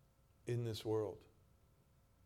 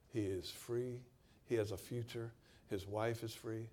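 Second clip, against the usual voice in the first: ordinary talk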